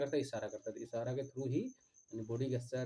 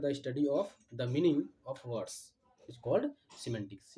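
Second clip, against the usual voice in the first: slow dictation; much slower than usual. Shown as a demonstration. Teacher dictating the definition to students, drawn-out and deliberate. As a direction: spell it out so the class can write it down.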